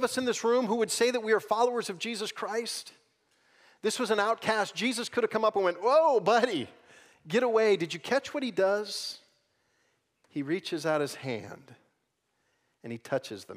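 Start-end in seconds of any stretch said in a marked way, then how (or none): 5.8-7.8 high, loud mock recoil; alarmed rebuff. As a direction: call out to ward someone off. Imagined rebuff of an outcast.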